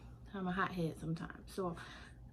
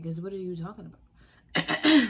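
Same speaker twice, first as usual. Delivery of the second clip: louder, slow, drawn-out comeback; indignant retort; 2 semitones lower, 9 dB louder, slower than usual. Retold or imitated. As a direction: hit him back crooked, slowly. Retorting crooked to boss.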